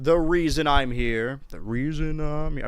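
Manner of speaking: loud, slow, hammed-up proclamation; mock grand declaration. To the kiddies, loudly proclaiming the game is why he's here. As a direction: announce it slowly and loud like a big speech.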